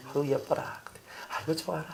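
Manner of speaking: put-on soft, doting murmur over a child; doting coo. Shown as a demonstration. Pastor cooing softly to show a loving heart. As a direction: coo it softly like a fond parent.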